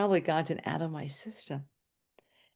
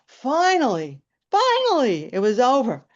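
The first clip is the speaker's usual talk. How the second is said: exasperated relief; 7 semitones higher, 9 dB louder than usual. Retold or imitated. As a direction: cry it out at long last, rising on the repeat. Exclaims relief that noise ended.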